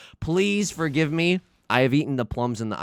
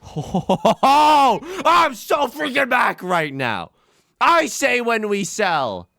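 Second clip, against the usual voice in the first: high, slow, stretched-out victory yell; excited shout; 10 semitones higher, 5 dB louder, much slower than usual. Real, not acted. Streamer celebrating a huge winning hand, ecstatic high yell. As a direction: belt it out like you just won big. Big win in mahjong game.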